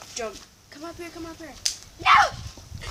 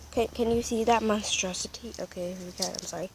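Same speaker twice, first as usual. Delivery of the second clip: ordinary talk, nothing marked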